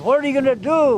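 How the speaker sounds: "do" pitched high and falling hard, demanding; a retold elder pressing the leaders; forceful challenge. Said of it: "very strong".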